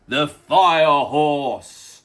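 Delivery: "the fire horse" drawn out very slowly; dramatic. Acted. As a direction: savour "the fire horse" like a trailer voice. Dramatic slow repeat.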